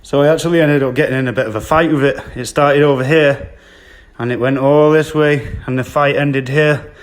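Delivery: breathless after a struggle; panting between words; out of breath. Real, not acted. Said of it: "I'm out of breath".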